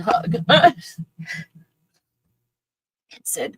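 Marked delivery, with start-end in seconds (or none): 0.4-1.4 high, breaking emotional laugh; teary laugh. Real, not acted. Emotional laugh thanking Crystal, teary and high. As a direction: laugh through tears of gratitude.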